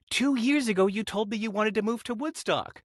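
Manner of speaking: imitated high, excited voice; Renee. Redhead reminds him of his old plan, imitated excitement. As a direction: explain in an excited high pitch, as her. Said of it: "Why are you so excited?".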